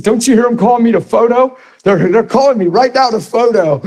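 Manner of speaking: imitating a flustered man in a high voice; high-pitched mock panic; freaked out, nervous. Man dodging prayer by claiming photo is calling him, nervous. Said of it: "so freaked out and nervous".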